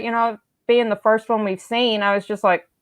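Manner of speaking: ordinary delivery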